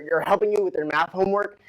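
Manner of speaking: ordinary talk, nothing marked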